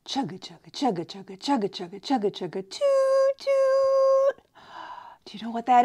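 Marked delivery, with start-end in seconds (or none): 0.1-2.6 chugging toy train voice. Shown as a demonstration. Imitating a train. 2.7-4.4 high train whistle toot. Shown as a demonstration.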